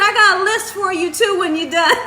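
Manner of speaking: high, loud, breaking into laughter; laughing retold quote. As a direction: quote the joke while starting to crack up. Quotes husband's joke, laughing.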